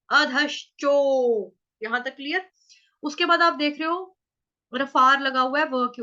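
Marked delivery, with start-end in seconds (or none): 0.1-1.5 अधश्चो very slow with a long ओ; slow demo. Shown as a demonstration. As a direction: draw out अधश्चो, each syllable apart. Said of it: "ओ".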